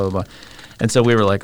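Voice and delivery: normal talking voice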